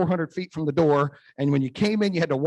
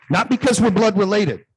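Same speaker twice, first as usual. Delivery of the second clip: louder and higher, forceful line; firm rebuke; 6 semitones higher, 7 dB louder than usual. Retold or imitated. Son drawing the line with his parents, louder and firm. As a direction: hammer the point home to your parents. Confronting parents over boundaries.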